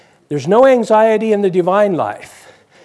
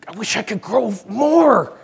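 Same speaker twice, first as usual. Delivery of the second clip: put-on wistful little boy; 5 semitones higher than usual. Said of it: "every year I was like".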